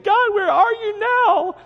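high, loud, whimpering cry for help; hammed-up frightened wail to God; mock whiny plea. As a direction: play the scared Israelites whining to God. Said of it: "they're crying out to the Lord saying".

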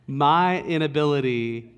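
normal talking voice

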